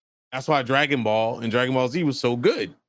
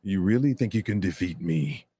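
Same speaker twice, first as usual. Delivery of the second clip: much lower, menacing villain voice; 6 semitones lower than usual. Shown as a demonstration. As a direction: sneer it down low like a cartoon villain. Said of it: "With villains, I could just be like".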